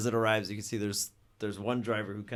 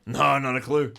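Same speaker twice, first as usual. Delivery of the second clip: much higher, louder gang minion calling his boss; rough minion voice; 4 semitones higher, 11 dB louder than usual. Acted. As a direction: shout it up to the boss like an eager henchman. Dez answers on radio.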